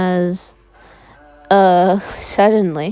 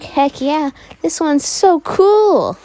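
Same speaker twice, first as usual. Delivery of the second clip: enthusiastic; 8 semitones higher, slower than usual. Acted. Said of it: "super enthusiastic about it".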